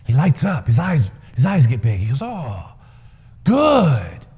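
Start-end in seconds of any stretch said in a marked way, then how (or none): 2.2-4.2 high, louder grand pronouncement; pleased old man. A narrator's reading. Old man answering the crowd, high and pleased. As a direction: savour it like a sage who wins.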